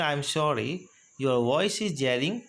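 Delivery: slow, clear example; slow model phrase. Shown as a demonstration. Teacher modeling a complaint about a jarring line, slow. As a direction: say it slowly for learners. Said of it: "तो कैसे बोलेंगे?".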